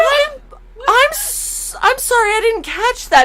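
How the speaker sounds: lightly acted high polite voice; overly polite apology. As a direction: play the bewildered polite newcomer. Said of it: "I was like".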